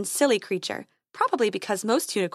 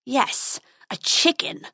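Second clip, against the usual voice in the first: snarl; 4 semitones higher, slower than usual. Acted. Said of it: "snarled the dragon".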